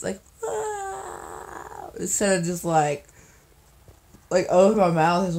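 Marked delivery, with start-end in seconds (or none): 0.0-1.9 soft, high, dainty yawn sound; breathy pretty yawn demo. Shown as a demonstration. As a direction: show off a cute little yawn. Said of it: "I wish I had, like, a really pretty yawning face".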